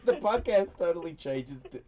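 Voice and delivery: real high-pitched laughing fit; laughing hard. Real, not acted. Host cracks up at co-host's porn podcast title, laughing. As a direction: talk through helpless laughter.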